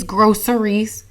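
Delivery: "groceries" slowly as GRO-sur-eez; "groceries", S not SH, unhurried. Shown as a demonstration. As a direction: say "groceries" with a clean "s" for the C. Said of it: "I was saying this shit wrong".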